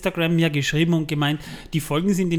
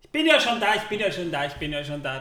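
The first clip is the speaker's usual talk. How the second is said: hurried arrival; faster than usual. Acted. Host hurries in late.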